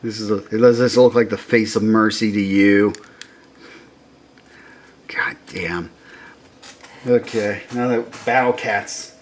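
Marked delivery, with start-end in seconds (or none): 5.1-5.9 soft unvoiced whisper; real hushed awe; awed whisper. Real, not acted. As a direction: breathe it out in wonder.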